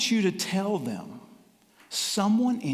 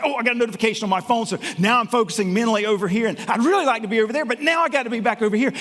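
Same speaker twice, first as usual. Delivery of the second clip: hurried inner monologue; 3 semitones higher, 3 dB louder, faster than usual. Retold or imitated. Speaker acts out his hectic day.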